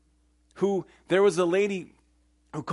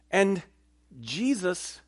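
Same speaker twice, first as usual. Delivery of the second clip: ordinary talk, nothing marked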